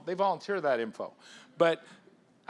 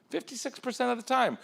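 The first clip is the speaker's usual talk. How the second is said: fifty-six percent of the time pitched much higher; repeated higher; 8 semitones higher than usual. Shown as a demonstration. Speaker repeating the statistic to the crowd, much higher. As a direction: lift the repeat up high for emphasis.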